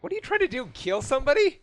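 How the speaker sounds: high, loud exasperated yell; angry scolding shout. Acted. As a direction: scold her, outraged. Seiza scolding after smacking Wendy.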